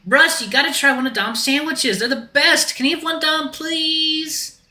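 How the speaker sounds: very high, eager begging; pleading Axel voice. Acted. Axel begs Dom for a sandwich, high pleading voice. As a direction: wheedle in a high little voice.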